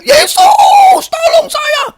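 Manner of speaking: very high, loud shout for help; retold desperate cry. Man on his knees re-enacts begging Jesus, desperate shout. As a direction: re-enact crying out to heaven. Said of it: "Saya berteriak".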